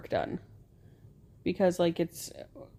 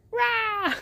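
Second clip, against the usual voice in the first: mock yell. Wife showing husband how she'd love to yell, mock yell. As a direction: let out a pretend "rah" yell. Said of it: "like, yell".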